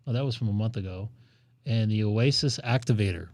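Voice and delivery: normal talking voice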